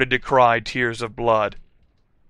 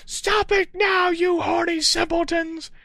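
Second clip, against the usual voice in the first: shouted. A narrator's reading. Professor shouting at the students to stop, high-pitched shout. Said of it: "shouted Professor McGoggle".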